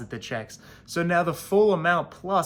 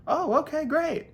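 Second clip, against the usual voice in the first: high bright teller voice, pitched way up; chirpy bank teller; 14 semitones higher than usual. Retold or imitated. Bank teller cheerfully agrees, high and chirpy. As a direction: play the cheery teller, squeaky and sweet.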